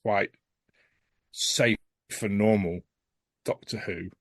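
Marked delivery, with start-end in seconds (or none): none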